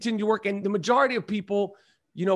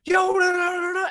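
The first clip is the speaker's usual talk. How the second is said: excited fan shout; 10 semitones higher than usual. Retold or imitated. Fan jumping out of his car, high excited shout. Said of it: "was like".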